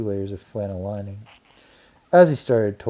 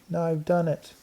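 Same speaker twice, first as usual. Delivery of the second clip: high dismayed mouse voice; a mouse realising his mistake; 8 semitones higher than usual. A narrator's reading. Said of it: "thought Ralph".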